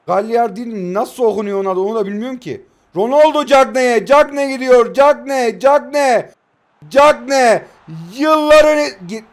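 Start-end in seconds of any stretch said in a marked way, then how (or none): 2.9-7.6 loud, rising match-commentator call; excited football commentary build-up; play-by-play commentary. Pretend. Mock commentary of an attack. 8.1-9.2 goal celebration cry. Real, not acted.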